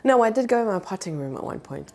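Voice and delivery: usual speaking voice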